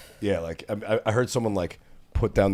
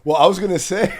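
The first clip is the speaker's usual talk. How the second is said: laughing out the words, louder and higher; 10 semitones higher, 8 dB louder than usual. Real, not acted. Host laughing at her story, laughing. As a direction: laugh through the line.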